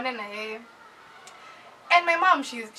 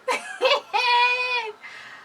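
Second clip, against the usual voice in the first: loud, high-pitched "hehehey" laugh-cry; high laughing wail; 12 semitones higher, 5 dB louder than usual. Acted. Daughter shown the phone by dad, high laughing wail. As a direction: squeal out a long dismayed laugh. Reacting to what dad showed her.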